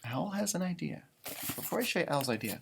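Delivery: ordinary delivery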